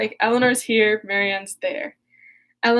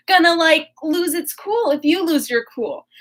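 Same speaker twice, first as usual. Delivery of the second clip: urgent; 5 semitones higher than usual. Retold or imitated. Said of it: "Eleanor's like".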